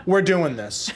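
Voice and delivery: retold with a laugh running through it. Telling friends about landing a first customer, laughing. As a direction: laugh out the words like a done deal.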